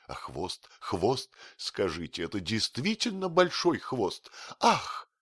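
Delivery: high, gushing admirer angling for agreement; ingratiating patter with excited high peaks. A narrator's reading. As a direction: fawn excitedly, leaping up in pitch. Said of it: "заискивающе спрашивал".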